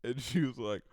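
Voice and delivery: usual speaking voice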